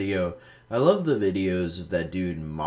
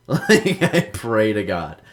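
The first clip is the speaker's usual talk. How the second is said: louder, higher through a real laugh; laughing emphasis; 4 semitones higher, 9 dB louder, slower than usual. Real, not acted. Laughing, louder repeat of remark.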